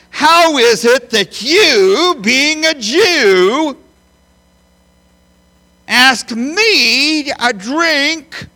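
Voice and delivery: high, loud, slow mocking challenge; sarcastic woman. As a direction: drag it out as a scornful retort to Jesus. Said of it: "emphatic that she was being sarcastic".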